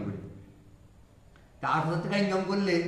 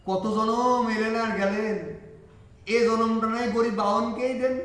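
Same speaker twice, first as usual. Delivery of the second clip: slow, higher, weighty recitation; solemn quoted saying; 5 semitones higher, 4 dB louder, slower than usual. Retold or imitated. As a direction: draw out each word like a sacred saying. Quoting the master's words to father.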